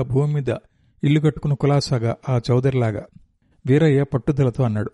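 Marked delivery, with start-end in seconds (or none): none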